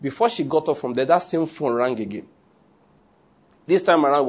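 usual speaking voice

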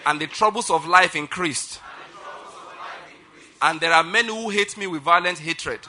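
loud, measured proclamation with pauses; preaching declaration. Acted. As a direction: declare it line by line for a congregation to repeat. Leads congregation in declaration.

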